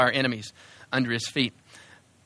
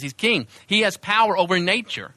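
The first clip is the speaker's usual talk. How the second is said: ordinary delivery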